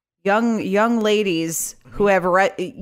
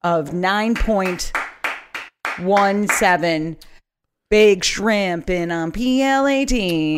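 slow deliberate; slower than usual. Acted. Slow mock-solemn verdict.

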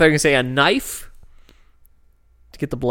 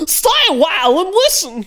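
much higher and louder squeaky voice; high squeaky dwarf voice; 5 dB louder than usual. Acted. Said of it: "It was something like that".

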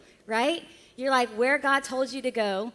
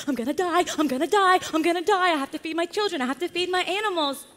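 high, panicky cartoon worrier; hammed-up anxious fretting; 5 semitones higher, 3 dB louder, faster than usual. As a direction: repeat it in a mounting mock panic. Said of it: "the anxious one is like".

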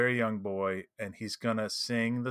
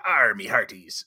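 loud mock pirate growl; 2 semitones lower, 6 dB louder, slower than usual. Player retelling what the pirate kept saying, pirate voice. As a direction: hail the crew like a stock pirate. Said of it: "And the pirate said".

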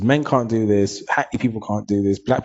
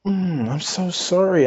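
mock apology; 9 semitones higher, slower than usual. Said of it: "I was like".

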